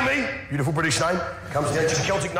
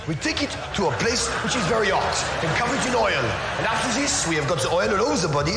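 put-on French accent; 2 semitones lower than usual. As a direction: describe it like a recipe in a French accent. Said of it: "described in a French accent".

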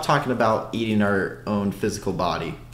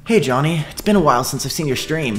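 higher, louder, giggly put-on voice; weird chat voice; 7 semitones higher, 4 dB louder than usual. Streamer reading a viewer's message aloud, odd higher giggly voice. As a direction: read the chat message like someone possessed. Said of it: "What the fuck was that voice?".

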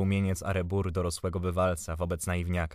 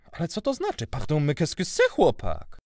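high, loud feigned bafflement; playing dumb; 7 semitones higher, 4 dB louder than usual. A narrator's reading. Uncle pretending not to understand the boy, playing dumb. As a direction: act as if you cannot understand at all. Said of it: "udawał Greka".

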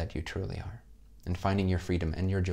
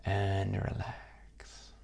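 calm meditation voice with long pauses; guided meditation. Acted. Teacher closing the course for students, guided meditation. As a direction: lead them gently into rest, leaving space between phrases.

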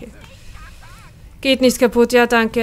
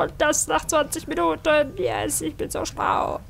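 villain gloat; 5 semitones higher than usual. Pretend.

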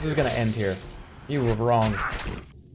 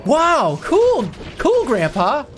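YouTuber voice; 9 semitones higher than usual. Acted. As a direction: gush like an excited YouTuber. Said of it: "The grandson has the YouTuber voice".

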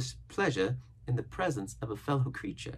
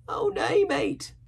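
very high, loud castaway voice; marooned castaway, protesting; 6 dB louder, much slower than usual. A narrator's reading. Castaway correcting the boy, protesting. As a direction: cry it out, high and emphatic.

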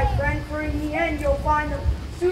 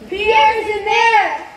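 loud, high, drawn-out cry; alarmed cry; 5 semitones higher, 9 dB louder, slower than usual. Acted. Parents finding their boy inside the lion, loud alarm. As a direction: shout the alarming discovery slowly.

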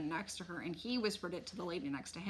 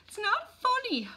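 very high, loud protest; high-pitched indignant insistence; insisting; 7 dB louder than usual. A narrator's reading. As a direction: object shrilly, as an upset mother. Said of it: "my mother insisted".

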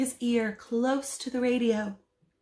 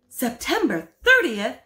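louder, eager startled question; excited; 3 semitones higher, 6 dB louder than usual. Acted. Husband realises the date, excited. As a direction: snap to attention with excitement. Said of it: "in an excited tone".

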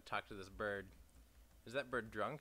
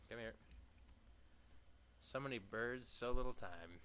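usual speaking voice

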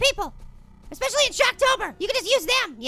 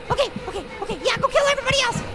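usual speaking voice

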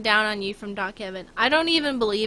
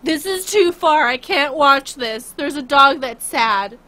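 louder, slow mock outburst; mock outrage; 4 semitones higher, 9 dB louder, slower than usual. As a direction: ham up being unable to watch. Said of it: "I'm like".

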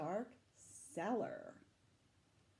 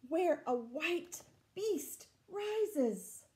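higher, chase-like narration; reading the chase to children, higher and eager; excited story reading; 9 semitones higher, 6 dB louder, slower than usual. A narrator's reading. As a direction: urge them on after the beast. Reading story text dramatically.

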